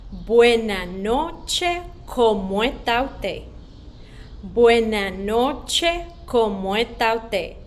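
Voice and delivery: slow clear call-and-repeat, syllable by syllable; slow spoken drill. Shown as a demonstration. Choir teacher drilling children, slow and clear. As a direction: lead the class word by word, unhurried. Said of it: "One more time".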